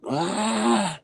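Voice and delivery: high, drawn-out mock scream; imitated scream. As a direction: imitate a sleeper's long high wail. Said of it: "So he stopped screaming".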